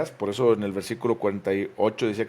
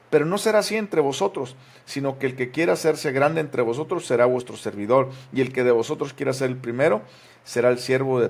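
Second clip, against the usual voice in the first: raised, louder solemn Bible reading; scripture reading; 2 semitones higher, 4 dB louder than usual. Acted. Pastor reads Mark aloud to church, raised proclaiming voice. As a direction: proclaim the verses to the congregation.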